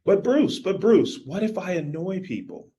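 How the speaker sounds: high, pleading mock worry; anxious student pushing back; worried objection. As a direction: whine like a nervous podcaster. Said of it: "(imagined listener objection)".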